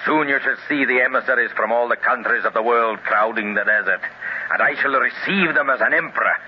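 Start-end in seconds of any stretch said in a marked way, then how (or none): none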